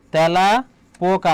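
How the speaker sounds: তেলাপোকা very slowly, "tyæla", then "poka"; syllable-by-syllable demonstration. Shown as a demonstration. Teacher slowing a word down for students, drawn-out demonstration. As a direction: break তেলাপোকা apart and stretch the open "æ". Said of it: "অ্যা হয়ে যাচ্ছে".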